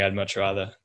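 ordinary talk

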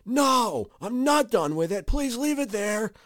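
high, exasperated mock yell; annoyed. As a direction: yell back high, stretching out the final plea. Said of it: "I finally get annoyed and say".

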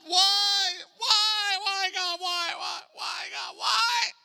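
mock despairing believer pleading upward; questioning God. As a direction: build from forlorn complaint to repeated high cries. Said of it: "start to question like this".

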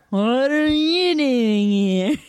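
high, very slow stretched question; twin voice. Acted. Host redoes the twin line for her cohost, high and slow. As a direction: draw out every word like a whiny kid.

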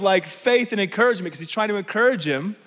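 ordinary talk, nothing marked

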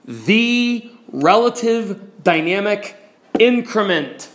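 "the relative dynamic increment" slow, word by word; measured emphasis, pausing before "increment"; underlined. Shown as a demonstration. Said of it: "underline this".

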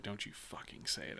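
hushed, soft whisper of a warning; whispered threat. Retold or imitated. Retelling warning coworker not to say it, whispered threat. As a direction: hiss it under breath. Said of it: "And I was like".

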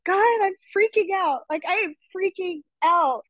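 mock panic. Guest tells host how she panicked praying to God, mock panic. Quoting herself panicking this morning.

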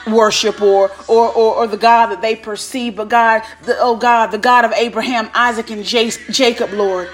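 normal talking voice

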